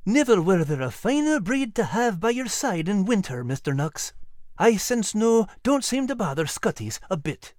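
put-on high-pitched Scottish old soldier; lilting Scottish greeting from an old soldier; genial old Scottish sergeant.

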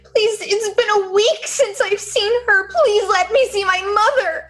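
put-on high, pleading child voice; frightened little girl. Lost girl begging the hero, high and pleading. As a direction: beg like a lost, cold child.

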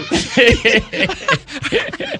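laughing out loud at the memory; the host cracks up telling the story. Real, not acted. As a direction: burst of loud high laughter. Laughs retelling the phone call.

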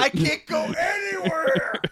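high, slow exasperated yell; exasperated shout. Retold or imitated. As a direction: wail it out, fed up. Voicing fed-up Arthur.

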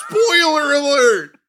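Spoiler alert shouted high and loud, falling at the end; teasing shout. Real, not acted. Friend mocking Pete for spoiling the movie, with a wailing shout. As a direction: yell "Spoiler alert" with a fake wail.

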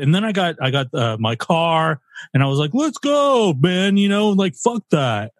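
loud, brash California bro going on about his sister; loud California guy accent. Shown as a demonstration. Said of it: "I'll try right now".

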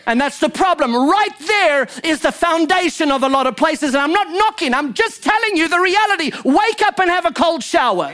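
high, emphatic pulpit delivery; impassioned preaching. Real, not acted. Preacher driving a point home to the congregation, impassioned. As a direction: preach it hard, pitch riding up.

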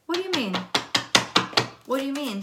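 high, insistent query; puzzled questioning. Real, not acted. Reader asking the cards to explain, high puzzled question. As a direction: quiz the cards in bafflement.

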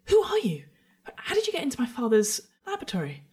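high, urgent demand; startled, high-pitched girl's questioning; alarmed teenage girl. Acted. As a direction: challenge the intruder in a high voice. Frightened girl confronts intruder after failed deception.

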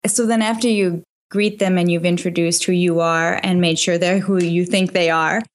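normal talking voice

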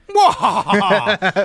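slow hammy evil laugh; mustache twirling villain laugh. Retold or imitated. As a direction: cackle it like a cartoon villain. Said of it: "he was basically mustache twirling".

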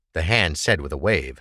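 plain reading voice

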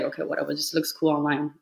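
normal talking voice

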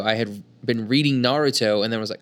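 usual speaking voice

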